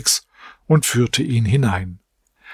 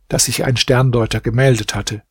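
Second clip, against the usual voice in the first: plain reading voice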